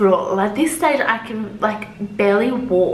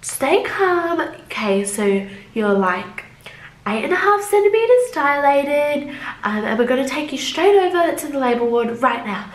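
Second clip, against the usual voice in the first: hidden panic; 6 semitones higher than usual. Retold or imitated. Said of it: "quite panicked".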